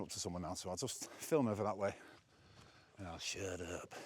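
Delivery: quick and hushed as someone passes; hushed aside to the camera as a walker comes near; dropping voice. Real, not acted.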